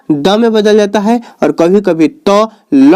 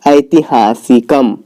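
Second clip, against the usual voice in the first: drawn-out, lower ऐतिहासिकम; slow model pronunciation; 5 semitones lower, slower than usual. Shown as a demonstration. Teacher giving the class the neuter form, slowly sounded out.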